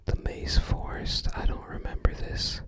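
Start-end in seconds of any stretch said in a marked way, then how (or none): none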